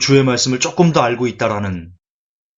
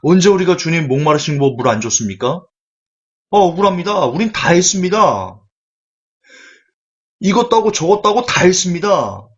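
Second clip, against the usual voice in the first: higher, louder, indignant whine; aggrieved goats protesting; 5 semitones higher, 3 dB louder than usual. Acted. The goats protest to the Lord, higher and aggrieved. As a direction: protest your innocence, wronged. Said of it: "그 염소들이 얘기합니다".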